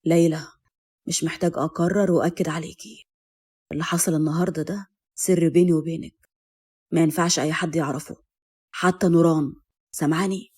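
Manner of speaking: low, restrained man's warning. A narrator's reading. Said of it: "وقال بلهجة تحذير".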